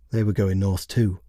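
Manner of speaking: usual speaking voice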